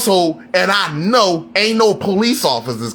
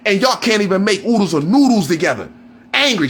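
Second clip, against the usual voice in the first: ordinary delivery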